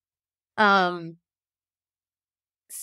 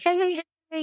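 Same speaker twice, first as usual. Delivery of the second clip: cheery greeting; 10 semitones higher than usual. Retold or imitated. Nurses greeting each other cheerfully.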